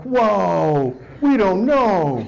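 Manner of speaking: high, very slow spaced-out mock stoners; stoned pair. Stoned couple answer the Mountie, slow baffled whoa. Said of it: "And they're like".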